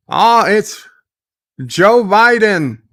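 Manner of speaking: high, loud, drawn-out reveal; excited announcement. Pretend. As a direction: hail a big-name guest. Announcing a donor with fanfare.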